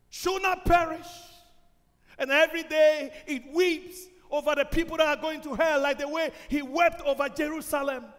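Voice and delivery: loud pleading sermon voice; full-force preaching. Acted. God's voice pleading loudly with the congregation. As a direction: plead with them by name.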